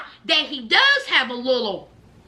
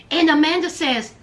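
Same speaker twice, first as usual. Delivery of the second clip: usual speaking voice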